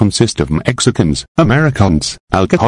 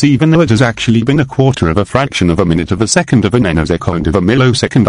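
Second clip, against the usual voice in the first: ordinary talk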